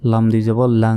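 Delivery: লাং with a long nasal hum; ghunna. Shown as a demonstration. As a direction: hold the ng of লাং through the nose. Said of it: "গুন্না হবে".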